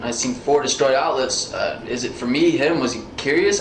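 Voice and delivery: normal talking voice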